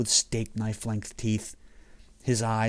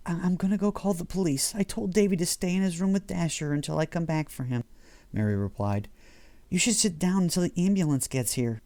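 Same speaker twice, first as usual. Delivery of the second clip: Mary's voice; 7 semitones higher than usual. A narrator's reading. Mary voiced as female character.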